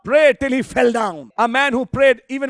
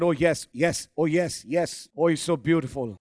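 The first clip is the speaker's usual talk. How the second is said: hushed, soft joy; softly overjoyed; 5 semitones lower, 4 dB quieter than usual. Acted. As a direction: breathe it out, moved and happy. Amram overjoyed after the visit.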